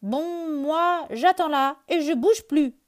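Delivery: high, loud sulky rabbit; grumbling. Acted. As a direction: grumble it like a stubborn child. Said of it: "bougonne Lapin".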